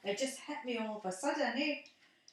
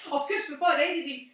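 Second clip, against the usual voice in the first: ordinary talk, nothing marked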